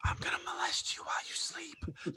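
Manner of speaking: a mocking creepy-guy whisper; mock whisper of a creepy stranger. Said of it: "like a creepy guy going".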